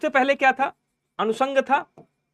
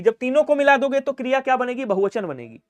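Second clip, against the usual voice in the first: ordinary talk, nothing marked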